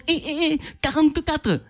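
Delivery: put-on excited kid butting in, high; youngest child. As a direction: pipe up like the baby of the family. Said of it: "il y a le petit dernier".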